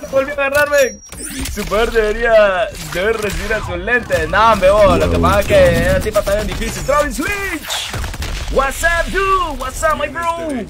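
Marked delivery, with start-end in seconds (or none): 0.0-1.0 higher, louder victory cry; triumphant shout. Real, not acted. Streamer cheering after grabbing the opponent again. 6.8-10.6 higher, slow drawn-out "What's up"; playful mock greeting. Streamer greeting a viewer in a playful put-on voice.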